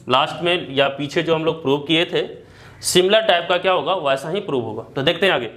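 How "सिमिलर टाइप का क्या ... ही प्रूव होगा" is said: usual speaking voice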